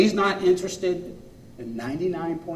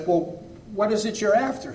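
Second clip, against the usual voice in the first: lightly voiced crafty bear, pitched up; a mildly sly, higher-pitched bargaining question; clever bear; 7 semitones higher than usual. Bear sounds out the hunter, lightly voiced clever probing. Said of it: "the bear very cleverly says".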